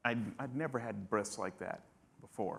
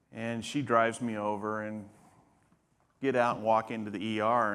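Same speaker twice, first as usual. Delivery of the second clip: usual speaking voice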